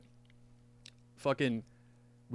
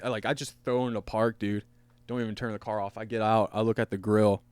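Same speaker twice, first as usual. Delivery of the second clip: normal talking voice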